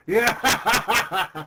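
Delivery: loud, high unhinged villain cackle; evil maniacal laugh. Acted. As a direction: cackle like a mad tyrant. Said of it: "This is my evil, maniacal laugh".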